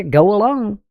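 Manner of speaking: ordinary delivery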